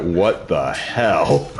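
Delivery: slow, drawn-out low disbelief; baffled reaction. Retold or imitated. Viewer reacting to Daniel Craig's Southern accent, slow bafflement. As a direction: stretch it out in mock bafflement.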